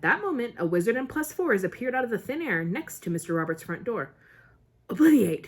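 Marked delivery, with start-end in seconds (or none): 4.9-5.5 sharp, louder spell; casting a memory charm, sharp and loud. A narrator's reading. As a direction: snap the spell out. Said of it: "he said sharply".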